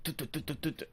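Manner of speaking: soft, rapid "tut" taps; imitated typing noise. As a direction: mimic two-finger pecking under your breath. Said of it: "but they're like".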